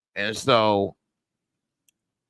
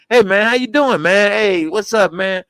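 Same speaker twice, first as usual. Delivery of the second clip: higher, louder mock greeting; imitated co-star; 5 semitones higher, 5 dB louder than usual. Sidekick greets the actor after the take, raised imitated voice. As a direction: imitate a co-star's upbeat hello. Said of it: "Then he started talking afterwards".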